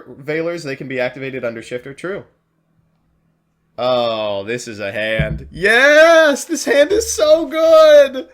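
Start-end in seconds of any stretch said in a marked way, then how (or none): 5.5-8.3 loud, high, drawn-out cheer; excited shout. Real, not acted. Streamer thrilled by his opening hand, loud shout. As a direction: celebrate the hand at full volume.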